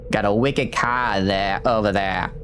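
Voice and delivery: slow Boston accent, R's dropped in "car" and "there"; drawn-out amateur Boston accent; attempted Boston accent. Shown as a demonstration. Streamer imitating Boston-born grandmother, rough slow Boston accent. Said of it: "I can't do a Boston accent".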